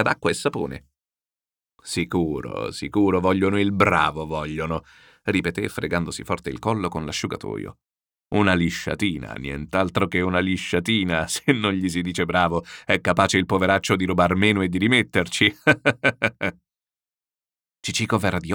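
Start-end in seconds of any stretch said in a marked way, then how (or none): 1.8-4.8 lightly voiced hearty general; gruff amused general. General drying his neck while mocking officials, amused gruffness. 8.4-16.6 lightly voiced chuckling old general; jovial general. General joking about flattering the poor thief, jovial.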